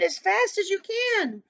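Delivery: high-pitched urging, falling on the last word; excited cry. A narrator's reading. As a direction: urge the riders on urgently. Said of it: "cried Dan, Grandude".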